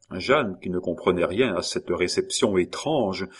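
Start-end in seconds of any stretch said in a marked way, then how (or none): none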